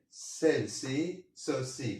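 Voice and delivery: ordinary talk, nothing marked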